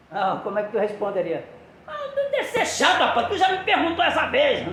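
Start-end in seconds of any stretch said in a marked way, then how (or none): 1.9-4.7 very high, laughing exasperation; mock annoyed reply. An imagined Peter tired of the question, high laughing whine. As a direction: whine it like you're fed up, laughing.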